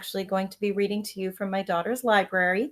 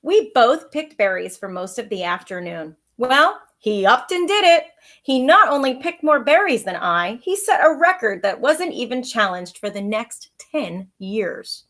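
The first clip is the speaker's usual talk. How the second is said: lively read-aloud storytelling voice; story narration; 8 dB louder than usual. A narrator's reading. Teacher reading a picture book to her pupils, lively storytelling.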